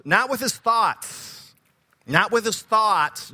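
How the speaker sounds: "Not with his thoughts" slowed, "with" leaned on; emphatic contrast. Shown as a demonstration. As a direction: press the contrast on "with". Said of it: "I want to point out".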